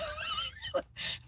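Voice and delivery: a teary laugh that breaks high; crying laugh. Real, not acted. Choking back tears for the camera, a teary laugh. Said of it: "trying not to cry".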